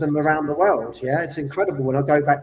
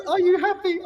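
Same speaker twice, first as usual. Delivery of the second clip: high, laughing question; 12 semitones higher than usual. Retold or imitated. Parents asking their son if he's happy, laughing. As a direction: ask through laughter. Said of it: "And they goes".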